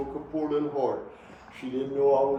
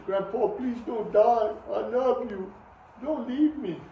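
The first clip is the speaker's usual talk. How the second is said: put-on high, slow childlike plea; pleading grandchild; 9 semitones higher than usual. Grandchild begging dying grandpa not to die, high and pleading. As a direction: beg like a little child at a deathbed. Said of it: "One of his grandchildren grabs his hand".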